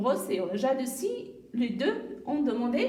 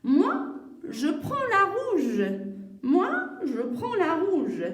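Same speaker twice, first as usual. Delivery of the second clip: loud, high, drawn-out declaration; child choosing; 4 semitones higher, 5 dB louder, slower than usual. Acted. Salima picks the red one, loud slow child's claim. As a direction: stretch it out slowly like an insistent kid.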